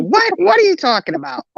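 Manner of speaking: high, incredulous mom imitation; mom's disbelief. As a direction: question it in a high, baffled mock-mom voice.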